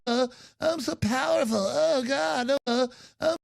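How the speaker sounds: high, breathy, self-adoring mock voice; mocking parody, pitched high; mock decadent elite. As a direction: swoon over your own power.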